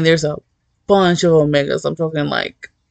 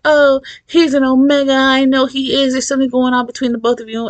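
jab at him in a raised, needling voice; mocking accuser; 7 semitones higher, faster than usual. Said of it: "And he's like".

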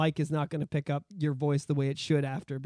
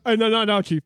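gym bro in the zone; 5 dB louder than usual. Retold or imitated.

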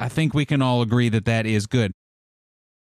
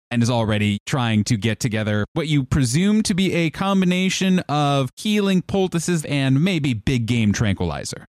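ordinary delivery

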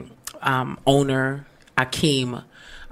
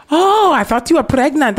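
surprised tow man; 10 semitones higher, 6 dB louder than usual. Retold or imitated. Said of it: "He's like".

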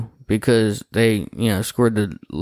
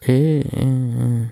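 slow drawn-out yeah; 2 semitones higher, much slower than usual. Real, not acted. Drawn-out agreement.

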